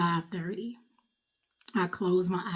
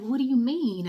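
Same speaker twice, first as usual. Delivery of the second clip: crying disbelief; 6 semitones higher, 5 dB louder than usual. Retold or imitated. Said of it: "And I'm crying".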